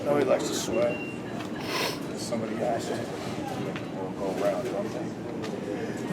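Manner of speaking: low, soft, held back; low quiet take of the line. Shown as a demonstration. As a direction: let it sink low and quiet. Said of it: "Put inflection in your voice".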